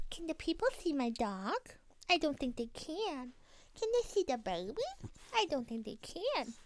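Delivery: high-pitched cutesy pet voice; baby talk to dog. Acted. Podcaster asks her dog if people can see, high baby talk. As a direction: coo like you're talking to a puppy.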